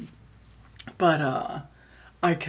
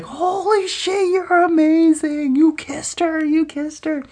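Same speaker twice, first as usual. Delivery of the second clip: amazed friend; 14 semitones higher, 4 dB louder than usual. Retold or imitated. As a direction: squeal it out in giddy disbelief. Friend amazed outside car.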